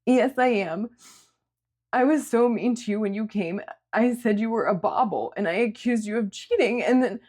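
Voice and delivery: high, upset voice near tears; self-loathing, fighting back tears; tearful young woman. A narrator's reading. Sierra berating herself to Julia, tearful.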